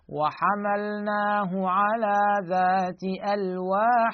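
slow chant ending on ودسر; tajweed reading stopping on ودسر; Quranic recitation. Shown as a demonstration. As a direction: recite slowly and end on a heavy ر in ودسر. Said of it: "هكذا".

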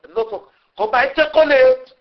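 ordinary talk, nothing marked

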